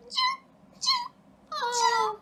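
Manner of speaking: high squeaky imitated chirping; beaver chirp. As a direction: chirp like a tiny baby beaver. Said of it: "I started hearing this little chirp".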